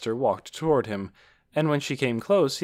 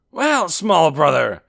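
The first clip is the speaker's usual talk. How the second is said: loud, slow, raised greeting; hearty big brother; 7 semitones higher, 11 dB louder, slower than usual. A narrator's reading. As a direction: boom a greeting to a little brother. Big brother hails excited younger one.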